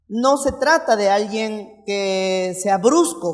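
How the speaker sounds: normal talking voice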